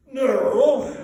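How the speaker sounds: loud, high blustering merchant; great fat man. A narrator's reading. Fat businessman dismissing the talk, loud blustering voice. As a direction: bark it out like a stout businessman. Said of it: "said a great fat man".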